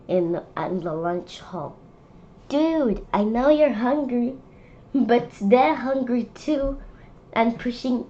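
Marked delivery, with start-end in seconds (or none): none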